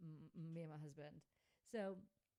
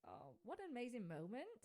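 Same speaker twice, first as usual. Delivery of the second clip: higher, gushing retold amazement; awed exclamation; 6 semitones higher, 4 dB louder than usual. Host reliving her reaction to the elephant's freedom, awed exclamation. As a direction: exclaim it, rising in pitch at the end.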